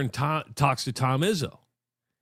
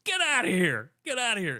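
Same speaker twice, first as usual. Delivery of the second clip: higher, shooing the player away; mock dismissive shout; 7 semitones higher than usual. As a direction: play the coach waving him off. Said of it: "Izzo goes".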